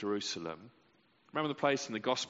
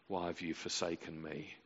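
1 hushed lament; 6 semitones lower, 8 dB quieter than usual. Retold or imitated. True quote of Jesus on cross.

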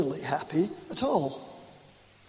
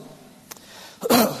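throat clearing; 5 semitones higher, 8 dB louder than usual. Real, not acted. Speaker with sore throat.

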